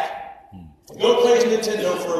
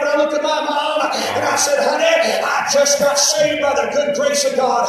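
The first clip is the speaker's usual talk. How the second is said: high, loud, driving revival shout; fervent testimony preaching; 7 semitones higher, 5 dB louder than usual. Real, not acted. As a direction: preach it at full force. Testifies at full force.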